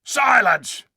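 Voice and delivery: booming high shout; thundering command. Acted. Tarkan silencing the dwarfs, thundering shout. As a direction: thunder it at the crowd. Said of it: "thundered Rishta Tarkan".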